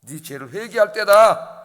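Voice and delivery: loud, slow call to repent; preaching emphasis. Acted. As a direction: hammer home each word of the call. (크고 느리게 회개를 외치듯)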